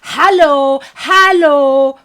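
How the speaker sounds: loud imitated German "Hello, hello" with an open "hæ"; a loud, drawn-out German greeting; mock German hello. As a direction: belt out a German-style "Hello" with a broad "hæ". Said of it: "they go".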